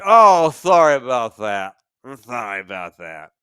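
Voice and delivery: slurred fake apology fading low; mock drunk apology. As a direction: ham up a sloppy drunk sorry. Drunk woman apologizing, mocked.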